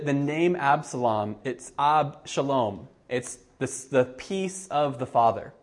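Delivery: usual speaking voice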